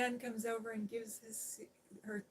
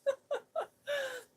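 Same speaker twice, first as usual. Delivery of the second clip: high, delighted laugh that breaks out; burst of laughter; 6 dB louder than usual. Real, not acted. Recalling movie nights with her friend, joyful laugh. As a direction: laugh out loud, overjoyed at the memory.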